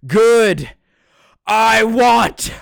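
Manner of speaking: high, loud hammed-up yell; mock shouting. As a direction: belt it out in pretend exasperation. Mock shouted outburst continues.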